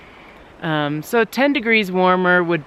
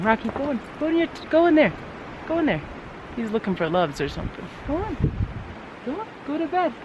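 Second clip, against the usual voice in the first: talking to a dog; 7 semitones higher, 6 dB quieter, faster than usual. Real, not acted. Shooing the dog into bed.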